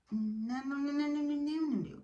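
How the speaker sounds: high, sustained run of no's; string the no's together up high; comic high-pitched chain of denial; mock refusal. Mocking disbelief, repeated no.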